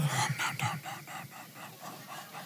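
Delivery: breathless whispered no, no, no, shrinking away; whispered refusal. Retold or imitated. Pastor imitating shy worshippers whispering a refusal. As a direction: whisper it like you're hiding from the hype-man. Timid congregation refusing to pray.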